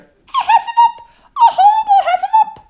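very high, piercing squeal; panicked, loud squeaky cry; Piglet crying out. A narrator's reading. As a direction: shriek for help as a tiny pig. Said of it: "cried Piglet".

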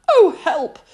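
stuck Pooh. A narrator's reading. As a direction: call out from inside a jar. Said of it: "he said inside the jar".

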